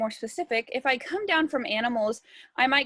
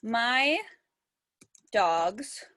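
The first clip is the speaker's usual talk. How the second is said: word by word, long pauses between; writing it out. Shown as a demonstration. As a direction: speak slowly as if writing each word down. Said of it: "So I think I'm going to put".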